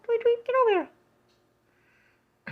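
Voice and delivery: high mock bird calling its friends; squeaky little bird bossing the others. Mom voicing a bird calling others to the feeder, high squeaky.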